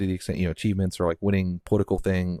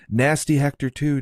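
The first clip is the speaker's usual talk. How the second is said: lightly attempted presidential impression, higher; half-hearted put-on politician voice, a bit louder; Trump; 7 semitones higher, 4 dB louder than usual. Podcast host jokes with co-host about a nickname, half-hearted impression. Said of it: "I can't do a Trump impression".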